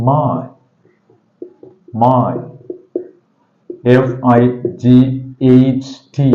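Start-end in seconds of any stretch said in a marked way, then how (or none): 0.1-2.3 "my" said carefully with a full vowel glide; model pronunciation. Shown as a demonstration. Teacher shows how it is pronounced, careful model "my". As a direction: say "my" as an isolated model word. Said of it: "It is pronounced this".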